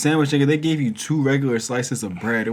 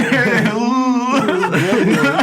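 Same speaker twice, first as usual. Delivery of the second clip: louder, higher talk broken by laughs; laughing; 8 semitones higher, 7 dB louder than usual. Real, not acted. Friends recalling greasy cafeteria tacos, laughing. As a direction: laugh through the memory.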